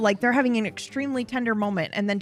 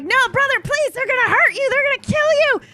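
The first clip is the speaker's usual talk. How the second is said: high, louder mock panic, climbing; pitched-up overwrought begging; hammed-up frantic plea; 4 dB louder than usual. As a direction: beg like a melodrama, rising higher. Hank begging brother to stop.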